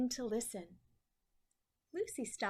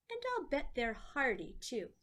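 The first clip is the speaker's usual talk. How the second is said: ordinary delivery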